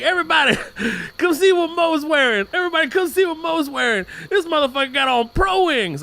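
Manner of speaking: a fifth grader announcing it to everyone; mocking classmate. Bully calling out the class.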